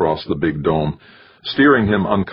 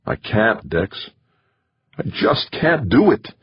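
pleading; 5 semitones higher than usual. A narrator's reading. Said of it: "Jamie pleaded".

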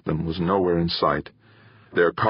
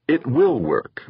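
ordinary delivery, nothing marked

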